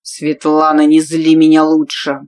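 loud growled warning; angry warning. Acted. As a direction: warn her before he loses control. Leader warns her angrily.

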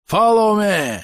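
high, drawn-out officer's call; rallying shout. Acted. British officer leading his men, rallying shout. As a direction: call the troops onward.

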